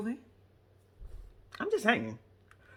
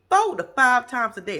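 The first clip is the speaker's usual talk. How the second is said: insisting; 3 semitones higher, 10 dB louder than usual. Retold or imitated. Travis insisting, retold.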